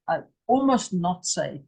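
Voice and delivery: normal talking voice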